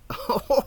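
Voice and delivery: put-on short high child's giggle; a quick playful giggle. A narrator's reading. The little boy giggling after standing on his head. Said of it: "Then he giggled".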